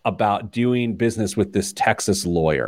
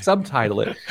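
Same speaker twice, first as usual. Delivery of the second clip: ordinary talk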